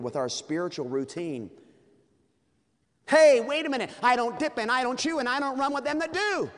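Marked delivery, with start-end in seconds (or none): 3.1-6.5 high, loud indignant protest; a hammed-up church boy boasting he is clean; loud, high self-righteous protest; smug churchgoer. Churchgoer bragging to the devil.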